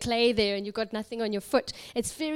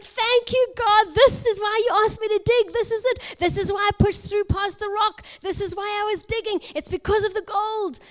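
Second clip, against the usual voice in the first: high, racing burst of acted joy; elated thanks; 8 semitones higher, 4 dB louder than usual. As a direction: pour out jubilant gratitude, pitched way up. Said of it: "his heart just lifts up".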